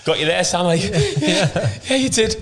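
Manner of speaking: giggling high through the quote; laughing. Retold or imitated. Retelling the punches to mates, laughing high. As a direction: squeak it out mid-laugh. Mocking retold punch exchange.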